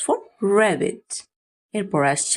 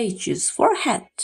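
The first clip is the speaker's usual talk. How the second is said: slow drill; 3 semitones higher, much slower than usual. Shown as a demonstration. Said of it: "slow teaching drill".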